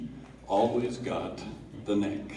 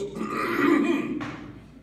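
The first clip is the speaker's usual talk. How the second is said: mock throat clearing; 3 dB louder than usual. A narrator's reading. Dad bares his throat to carve.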